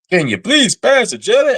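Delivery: loud, high Southern American twang; Southern American accent. Retold or imitated. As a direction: belt it out like a Southern American diner. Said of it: "Southern American accent".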